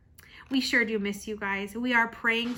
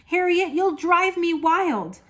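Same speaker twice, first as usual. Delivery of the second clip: higher, louder exasperation short of yelling; exasperated mother; 6 semitones higher, 5 dB louder, slower than usual. A narrator's reading. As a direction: let the frustration rise without shouting. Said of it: "Her mother didn't like to yell".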